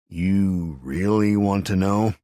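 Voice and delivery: slow, loud goading question; taunting question. A narrator's reading. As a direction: drag it out to stall. Stalling his captor.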